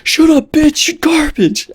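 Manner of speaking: very high, mock-screamed insult; imitated trash-talk shouting. Beaten opponent to the winner in game chat, high mock yelling. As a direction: screech it like an angry opponent in the lobby. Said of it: "you hear the other team shouting".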